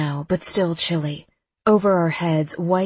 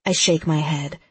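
ordinary delivery

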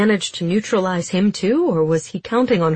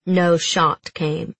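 ordinary delivery

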